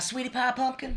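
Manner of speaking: sweet Southern drawl. Retold or imitated. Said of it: "she speaks so slowly".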